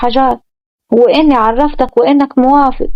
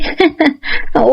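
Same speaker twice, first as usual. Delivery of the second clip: put-on girlish laugh; 3 semitones higher than usual. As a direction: giggle with delight. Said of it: "سارة بضحك".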